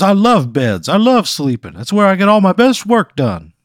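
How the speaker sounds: high, loud blustery cartoon rooster voice; Foghorn Leghorn. Scene: the time-travelling quilt introduces itself to Pat, in a blustery cartoon rooster voice. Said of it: "Foghorn Leghorn".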